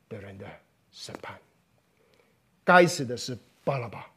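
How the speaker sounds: a preacher hushing to a solemn close; solemn preaching. Acted. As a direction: let it sink in quietly, almost whispered at the end.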